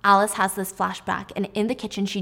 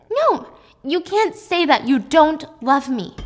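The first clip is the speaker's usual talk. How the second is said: higher, louder, slow denial; fearful angry denial; 7 semitones higher, 7 dB louder than usual. Acted. Alice rejecting Jack's love, loud and frightened. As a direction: push him away with your voice. Acted quarrel, Alice refuses.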